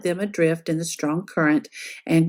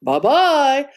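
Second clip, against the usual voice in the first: big bear voice, a touch gruff; 5 semitones higher, 4 dB louder than usual. A narrator's reading. Papa Bear calling goodbye to the girl, gruff. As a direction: call out like the big father bear. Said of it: "a big, gruff voice".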